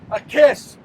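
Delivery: normal talking voice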